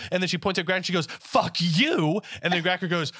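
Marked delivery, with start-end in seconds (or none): 1.1-2.2 high, loud, indignant; angry curse. Acted. Talia pointing at Gracker, high and angry. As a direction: throw it at the next target, high and forceful.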